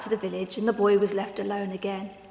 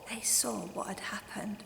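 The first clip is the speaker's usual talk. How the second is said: soft hushed whisper; 13 dB quieter than usual. A narrator's reading. Narrator revealing the loss in a whisper. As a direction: breathe the grim news.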